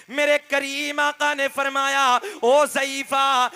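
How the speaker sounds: fervent, drawn-out preaching delivery. Acted. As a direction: preach the scene to the crowd with feeling. Preacher narrating a naat story. (जोशीला वाअज़ का अंदाज़)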